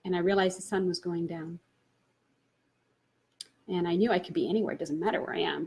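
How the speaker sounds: ordinary delivery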